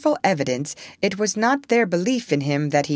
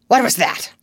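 sudden alarmed, higher and louder; startled; 5 semitones higher, 6 dB louder than usual. Acted. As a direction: snap alert at a noise. Pirates startled by a sound.

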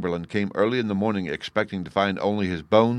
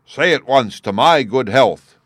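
loud, slow commanding king's cry; booming warrior king; 5 semitones higher, 9 dB louder than usual. A narrator's reading. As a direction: order it like a furious monarch. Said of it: "cried the king".

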